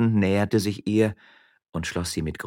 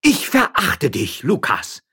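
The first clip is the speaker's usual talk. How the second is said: loud, high, slow outburst of scorn; vehement contempt; 10 semitones higher, 9 dB louder, slower than usual. Acted. Wife to her husband the miller, vehemently contemptuous. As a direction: hurl the scorn with full force. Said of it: "So heftig waren Gebärde und Tonfall".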